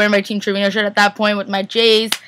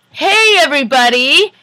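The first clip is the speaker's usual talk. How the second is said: loud, high, drawn-out hello; big sing-out greeting, imitating someone; mimicked entrance greeting; 5 semitones higher, 5 dB louder than usual. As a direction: call out slowly to a whole room. Imitates his entrance greeting the room.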